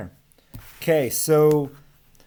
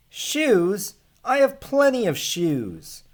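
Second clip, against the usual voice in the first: much higher, put-upon little man brushing him off; grumpy short man; 9 semitones higher, 4 dB louder than usual. Acted. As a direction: squeak it out like a small fussy man. Short man answers shoemaker.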